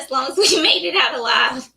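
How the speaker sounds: ordinary talk, nothing marked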